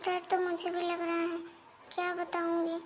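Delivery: very soft, scared murmuring; two sisters-in-law whispering nervously to each other, very soft; hushed fearful talk. Acted. Scared sisters-in-law hush together.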